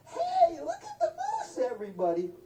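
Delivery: piercing, very high excited holler; loud shrill shout. Retold or imitated. Somebody from Alaska alerting everybody, loud shrill shout. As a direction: shriek it out for the whole camp. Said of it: "said in a real loud, shrill voice".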